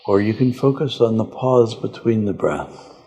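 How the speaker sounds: slow, calm meditation guide voice; guided meditation. Acted. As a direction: lead them gently inward, unhurried.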